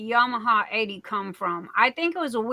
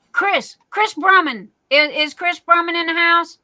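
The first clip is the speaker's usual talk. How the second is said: higher, louder call into the room; 6 semitones higher, 5 dB louder than usual. Real, not acted. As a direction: call him out like a host summoning a guest. Calling out for a viewer.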